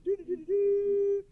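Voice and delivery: put-on high, sung-out beeping of an old cassette; mimicking the old tape signal, high and even; tape beep imitation. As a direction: hum the tape's side-change tone.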